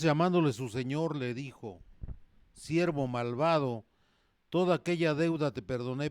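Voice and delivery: loud, forceful pulpit reading; full-force sermon voice; fiery preaching. Acted. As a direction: preach it out to the whole church.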